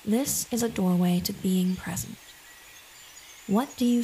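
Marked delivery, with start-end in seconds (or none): none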